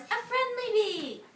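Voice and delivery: high, loud proud guess; eager guess. Acted. Morris proudly guessing for Boris, high eager cry. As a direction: offer the answer brightly. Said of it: "cried Morris".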